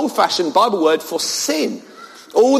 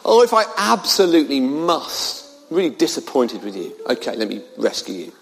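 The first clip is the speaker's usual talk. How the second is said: mock-unwilling, as if it's a chore; reluctant, dragging his heels; 7 semitones lower, 3 dB quieter than usual. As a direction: lower and softer, grudging sigh of a rescue. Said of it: "Kind of dragging his heels".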